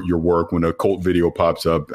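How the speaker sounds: ordinary delivery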